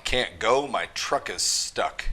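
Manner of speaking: ordinary delivery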